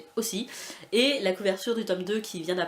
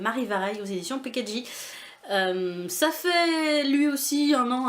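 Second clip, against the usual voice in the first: ordinary talk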